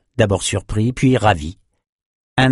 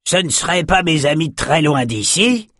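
higher-pitched, lightly voiced fisherman; raised-pitch greeting question; fisherman calling over; 7 semitones higher than usual. A narrator's reading. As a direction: toss the question across to old friends. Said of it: "lança le pêcheur".

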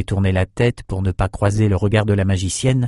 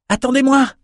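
high-pitched call to stop; young man hailing a departing ship; crying out. A narrator's reading. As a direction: call out urgently in a much higher voice. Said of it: "cria-t-il".

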